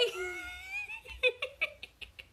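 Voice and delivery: long high-pitched giggle, breaking up; high squealing laugh. Real, not acted. Trainer cracking up over the client's joke, high squealing laugh.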